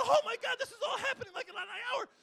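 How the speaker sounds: loud, high, laughing amazement; almost shouting with disbelief; excited. Retold or imitated. Photographer seeing the leopard arrive, loud high laughing amazement. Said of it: "And I'm thinking".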